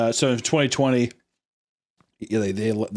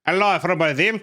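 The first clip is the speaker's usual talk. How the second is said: higher, badly faked Brazilian accent; mock Brazilian accent; 7 semitones higher than usual. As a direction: put on a rough Brazilian accent. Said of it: "if that's a good accent".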